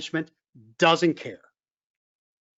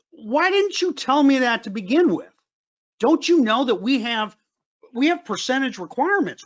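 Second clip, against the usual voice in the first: higher-pitched, mildly incredulous questioning; mild retold exasperation; 6 semitones higher than usual. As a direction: press them as the retold officer, voice raised in pitch. Officer retold challenging the woman.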